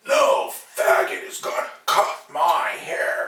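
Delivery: slow, hoarse sneer of the customer; mock bigot voice. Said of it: "he turns around and he goes".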